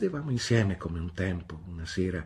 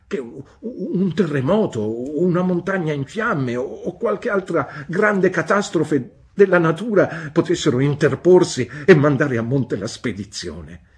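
higher, louder feverish dread; building excitement; 9 semitones higher, 10 dB louder than usual. A narrator's reading. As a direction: pile up the catastrophes breathlessly. Narrator's feverish fear.

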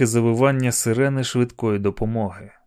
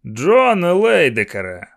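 drawn-out, raised hailing call; 5 semitones higher, 4 dB louder than usual. A narrator's reading. Man hailing the policeman by full name, drawn-out shout. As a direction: stretch the name to carry far. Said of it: "крикнув він".

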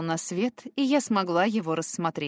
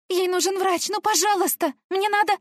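much higher, louder tearful begging; desperate pleading; 12 semitones higher, 4 dB louder than usual. Acted. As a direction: plead frantically through tears. Sobbing girl begging the stranger.

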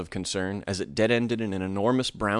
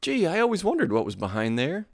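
put-on high, hesitant child voice; a wary little boy asking; a boy stammering at the door; curious boy; 7 semitones higher than usual. A narrator's reading. Boy speaks.

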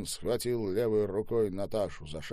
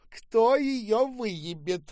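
slow, very high, loud cry; shouting; 7 dB louder, much slower than usual. A narrator's reading. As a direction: shout it out, stretching the words. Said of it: "закричала Ольга". (протяжный громкий выкрик)

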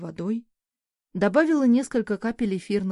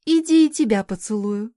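louder, teasing invitation; playful calling; 2 semitones higher, 7 dB louder than usual. A narrator's reading. Mother offering the cat kisses, playful call. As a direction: call the cat over loudly, in play.

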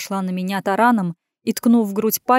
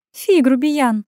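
higher, louder huffy woman; disdainful snort; 5 semitones higher, 4 dB louder, slower than usual. A narrator's reading. Woman answering his threat, snorting disdain. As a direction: scoff at his rudeness. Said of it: "фыркнула она".

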